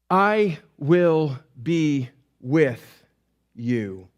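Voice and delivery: very slow, word by word; solemn promise. Acted. God gives Moses the message, slow solemn promise.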